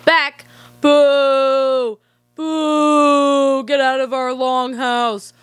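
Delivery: a lightly voiced jeering crowd; mock booing crowd. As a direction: boo him out like a whole village. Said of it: "everyone's like".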